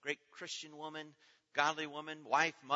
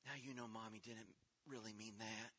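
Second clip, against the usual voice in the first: gentle consoling; 5 semitones lower, 12 dB quieter than usual. Retold or imitated. Little brother consoling crying sister.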